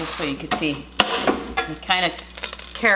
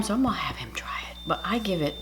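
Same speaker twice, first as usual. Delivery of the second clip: hushed aside to camera; whispering; 6 dB quieter, faster than usual. Real, not acted. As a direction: whisper so he won't hear. Husband just got home.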